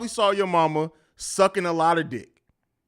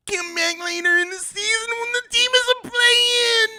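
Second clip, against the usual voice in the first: much higher, crying wail of a whiny fan; Bulls fan voice; 4 dB louder than usual. Acted. Mocking a Bulls fan crying about his team, wailing. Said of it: "Gotta stop doing that Bulls fan voice".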